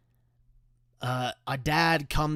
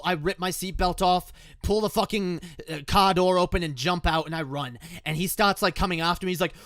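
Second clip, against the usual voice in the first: ordinary delivery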